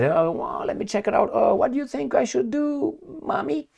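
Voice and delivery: put-on high, whiny little-boy voice; childish Macron. Macron asking his wife for help, in a high childish whine. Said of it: "went to, uh, Brigitte Bardot and said".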